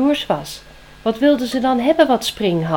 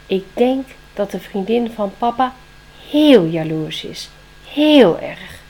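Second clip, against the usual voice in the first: grave owl; 7 dB louder than usual. Acted. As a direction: lean hard on the verdict. Owl judges the girlfriend.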